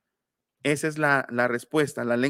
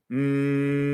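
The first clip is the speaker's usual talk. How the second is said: humming M. Shown as a demonstration. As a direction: hold a voiced hum "Mm". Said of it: "hagan una M".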